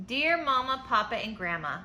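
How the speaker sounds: storybook letter read aloud; reading to children. A narrator's reading. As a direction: read it like a bedtime picture book.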